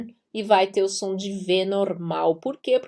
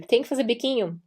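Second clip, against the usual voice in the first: usual speaking voice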